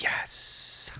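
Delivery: soft, voiceless "yes" of delight; whispered triumph. Retold or imitated. Recruit thrilled by California orders, whispered triumph. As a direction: whisper a private victory cheer.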